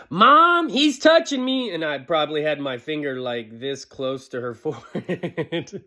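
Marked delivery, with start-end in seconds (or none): 0.1-1.7 high, loud whine to Mom; whiny tattling little sibling. Retold or imitated. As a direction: tattle like a bratty little sister. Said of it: "she's like".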